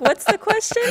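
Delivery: high, loud, laughing imitation of the guest; host mimics the guest, then laughs; laughing mock question. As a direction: tease him while cracking up. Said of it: "he's like".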